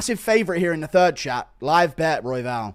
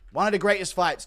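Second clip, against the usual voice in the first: ordinary talk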